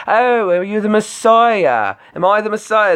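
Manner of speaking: Monty Python falsetto. Retold or imitated. Retelling a Monty Python bit to stream chat, high falsetto. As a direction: squeak it out like a Python housewife.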